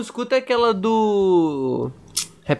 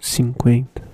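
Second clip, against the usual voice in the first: ASMR voice; 5 semitones lower, slower than usual. Real, not acted. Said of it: "Que vozinho só que você falou agora".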